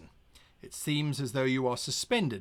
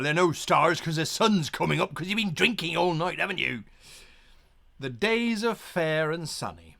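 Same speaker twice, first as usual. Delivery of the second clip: ordinary delivery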